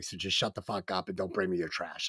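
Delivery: low, hushed, controlled menace; calm quote. Retold or imitated. Film character threatening his boss, very calmly. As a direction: keep the threat soft and even. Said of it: "very calmly just saying".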